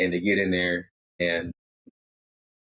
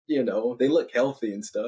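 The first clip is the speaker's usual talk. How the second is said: plain reading voice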